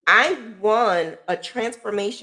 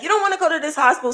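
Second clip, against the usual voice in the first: hurried warning; 9 semitones higher, much faster than usual. Retold or imitated. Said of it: "They was like".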